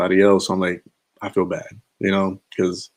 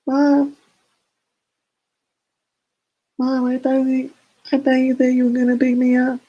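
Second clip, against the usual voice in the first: put-on much higher falsetto, a kid whining to mom; bad put-on voice. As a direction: fake a much higher voice, badly, calling for mom. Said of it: "I have the worst voice to do this 'cause it's terrible at doing voices".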